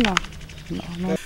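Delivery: ordinary delivery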